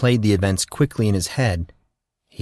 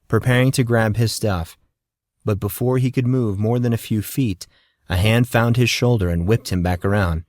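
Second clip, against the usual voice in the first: ordinary delivery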